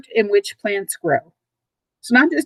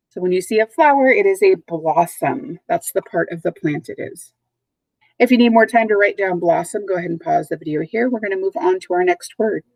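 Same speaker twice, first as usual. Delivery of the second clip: ordinary talk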